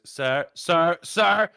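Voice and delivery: retold guard's escalating hail; increasing urgency. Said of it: "increasing urgency with repetition".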